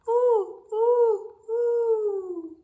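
high, loud hooting; owl hoot. Acted. Reader voicing the owl for children, loud hoot.

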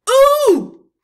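high, loud shouted ooh. Acted. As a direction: let out a shrill loud ooh. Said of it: "and then it shouted".

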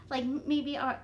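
ordinary delivery, nothing marked

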